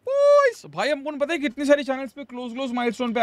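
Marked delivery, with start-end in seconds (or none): 0.1-0.6 high excited hype shout. Real, not acted. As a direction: pump up the viewers. Hyping viewers for milestone.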